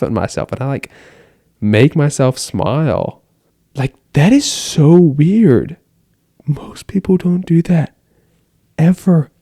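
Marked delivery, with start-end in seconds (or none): 3.7-5.8 slow, louder, higher, marveling at his own habit; amused disbelief. Real, not acted. Teen vlogger amused by his own mirror talks, slow and marveling. 6.5-9.3 hushed, higher, slow confiding whisper; whispered aside. Real, not acted. Teen vlogger confiding his odd habit to viewers, whispering.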